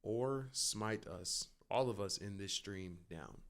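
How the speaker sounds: hushed, slow mock prayer. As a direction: pray in a solemn hush.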